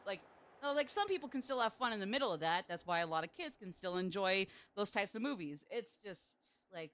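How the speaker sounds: usual speaking voice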